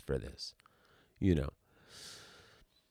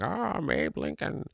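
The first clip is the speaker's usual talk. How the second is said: high, put-on statesman voice; hammed-up impression in a raised voice; Abe Lincoln; 6 semitones higher, 3 dB quieter than usual. Mocking an actor strolling the memorial in character, put-on Lincoln voice. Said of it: "he walks around going".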